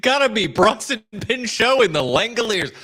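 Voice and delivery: mock-grand, high-pitched enthusiasm; emphatic echo. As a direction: crow it like a big endorsement. Loudly echoing the other speaker's pick.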